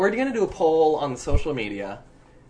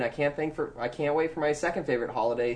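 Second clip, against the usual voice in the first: normal talking voice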